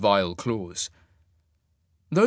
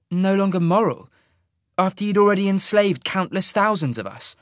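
higher, louder woman's voice, throwing the word back; indignant young woman; 8 semitones higher, 5 dB louder than usual. Acted. Sasha retorting to Isaac, a higher indignant echo. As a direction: echo moral with bitter disbelief.

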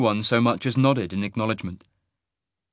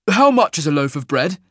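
louder, higher sudden question; abrupt, forceful enquiry; 6 semitones higher, 7 dB louder than usual. A narrator's reading. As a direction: blurt the question out. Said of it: "he asked abruptly".

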